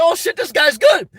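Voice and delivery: high, excited mock exclamation; impressed crowd. Crowd reacting to his run for twenty twenty, excited. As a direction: exclaim like a crowd suddenly won over. Acts out crowd won over by him.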